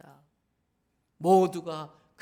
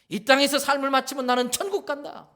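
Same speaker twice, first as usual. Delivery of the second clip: loud, raised declaration of faith; certain conviction; 6 semitones higher, 7 dB louder than usual. Acted. Preacher voicing early believers sure of heaven, loud conviction. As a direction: proclaim it high and loud, utterly sure. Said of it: "이 믿음이 너무나 분명했었어요".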